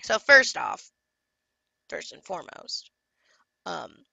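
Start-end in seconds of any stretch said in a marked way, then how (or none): 1.9-2.9 breathy whispered aside. Real, not acted. Telling viewers the client story, whispered aside.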